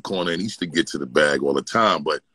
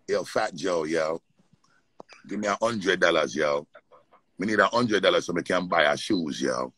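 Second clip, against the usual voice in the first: softer imitation of a Jamaican accent; 4 dB quieter than usual. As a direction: Jamaican accent with a tacked-on American "yo".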